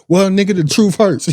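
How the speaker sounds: mom's blunt comeback. Retold or imitated. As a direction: play the mother firing back, high and loud. Mom's retort, voiced in story.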